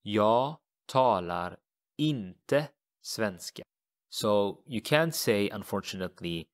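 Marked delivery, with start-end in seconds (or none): 0.0-3.6 Jag talar inte svenska, slow, stress on inte; slow teacher demo of the Swedish; slow model sentence. Shown as a demonstration. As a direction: model the negation slowly. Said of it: "So what would that be?".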